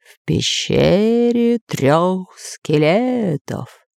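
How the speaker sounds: very slow, wavering-pitched spirit answer; ghost voice. Acted. Spirit names the cave, slow ghostly voice. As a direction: draw out each word eerily.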